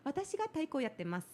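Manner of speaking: quick, high Japanese sentence. Shown as a demonstration. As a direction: lift your voice up for Japanese. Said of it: "my voice goes up naturally".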